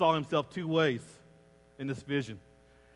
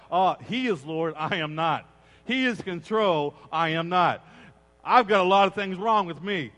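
preached confession; 6 dB louder than usual. Acted. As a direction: cry it out to the church. Preached confession quoted at volume.

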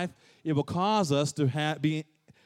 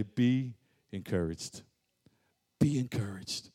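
low, soft and slow reassurance; hushed, tender encouragement; small soothing voice; 7 semitones lower, 8 dB quieter than usual. Acted. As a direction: breathe it gently like a comforting spirit. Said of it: "that small, soothing voice".

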